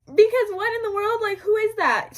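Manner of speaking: high laugh breaking into a shout. Real, not acted. Laughing at the girl who claimed to know her, then yelling. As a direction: crack up, then yell the question.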